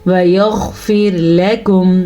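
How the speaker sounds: وَيَغْفِرْ لَكُمْ slowly with a heavy غ; slow recitation, heavy غ and light ر in وَيَغْفِرْ; full-mouthed ghain. Shown as a demonstration. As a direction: thicken the غ in وَيَغْفِرْ. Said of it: "کیسے غین کو پر کیا".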